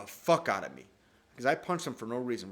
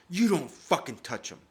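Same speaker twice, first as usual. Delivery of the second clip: loud protective snap at the son; mom's shout; 5 semitones higher, 9 dB louder than usual. Retold or imitated. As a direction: mom shutting him down, loud. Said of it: "My mom goes".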